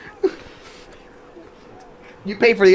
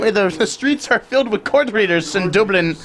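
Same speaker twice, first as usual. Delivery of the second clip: put-on Irish accent; 4 semitones lower than usual. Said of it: "Why am I having an Indian accent".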